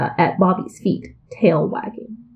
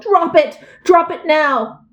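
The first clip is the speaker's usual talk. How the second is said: yelled command; 7 semitones higher than usual. A narrator's reading. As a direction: order the puppy to drop it. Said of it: "Bobby yelled".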